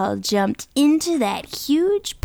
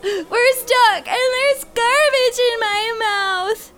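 panicked stuck dad; 8 semitones higher, slower than usual. Acted. Dad stuck in garbage pleads.

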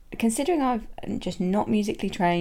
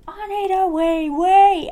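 loud, high sung-out call up the stairs; slow, loud, high wail of a need; shouting; 11 semitones higher, 8 dB louder, slower than usual. Retold or imitated. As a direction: belt it out like you're calling the whole house. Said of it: "I will shout".

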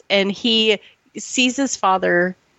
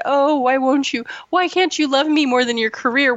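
higher-pitched pleading lover, imitated; mock plea; 6 semitones higher than usual. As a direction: plead as if putting on a needy sweetheart. Voices a hypothetical lover's plea.

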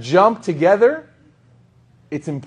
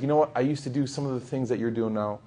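dropped to a soft, earnest hush; quiet sincere advice; 3 semitones lower, 9 dB quieter than usual. Pretend. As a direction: gently warn a friend, almost under your breath. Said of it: "tell them, like".

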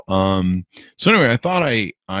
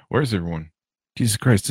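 ordinary talk

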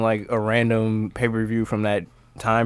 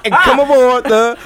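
high, loud, hugely stretched-out catchphrase; The Godfather; 13 semitones higher, 6 dB louder, much slower than usual. Retold or imitated. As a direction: holler it like a train call to the crowd. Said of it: "he would be like".

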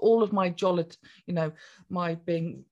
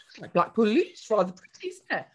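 airy posh-ish cheer, lightly raised; jolly chirpy tone; 4 semitones higher, 3 dB louder, faster than usual. Retold or imitated. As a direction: say it like admiring fairy lights. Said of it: "I actually said it in that tone of voice".

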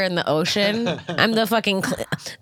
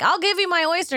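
much higher, eager pleading offer; 11 semitones higher, faster than usual. Retold or imitated. Offering her own oyster card to stressed friend, high and pleading. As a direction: plead brightly to end the search. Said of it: "I was like".

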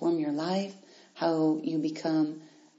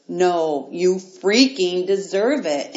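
louder, slow, forceful; emphatic insistence; 8 dB louder, much slower than usual. Real, not acted. Pushing listeners to believe they deserve it, loud and insistent. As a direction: drive it home, leaving them no room to doubt. Urging listeners not to shrink back.

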